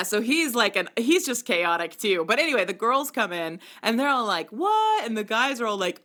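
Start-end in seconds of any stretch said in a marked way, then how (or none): 4.5-5.0 high mock-shocked "What?". Girls on the show reacting in shock, high and surprised. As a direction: squeal it in disbelief.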